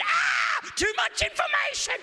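very high, loud shriek; meltdown scream. Retold or imitated. Preacher reenacting the ticket woman's horror, high screamed meltdown. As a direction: shriek it in comic overload. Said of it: "had a meltdown and said".

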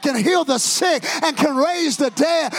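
loud, high, sing-song preaching; whooping preacher at full volume; full-force preaching. Acted. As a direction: drive the crowd to praise.

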